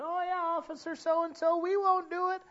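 high, meek voice of schoolkids; mock kids' promise. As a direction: play the kids who agree without meaning it. Mocking kids' easy promise.

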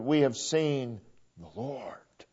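hushed whisper, slow. Acted. Pastor repeating the disciples' words in a slow whisper. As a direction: breathe it like a secret wonder.

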